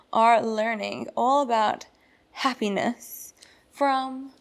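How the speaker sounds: ordinary delivery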